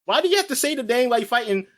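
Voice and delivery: fast, high, baffled why; incredulous question. Retold or imitated. Mocking anime heroes for naming moves, fast high disbelief. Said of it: "I was thinking".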